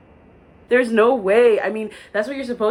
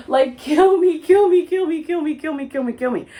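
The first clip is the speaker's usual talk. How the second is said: mock despair; 7 semitones higher, 4 dB louder than usual. Real, not acted. Despair at prices.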